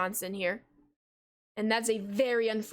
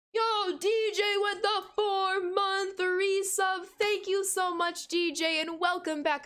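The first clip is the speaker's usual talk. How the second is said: hyped thanks; 8 semitones higher, slower than usual. Real, not acted. Hyped greeting of a resub.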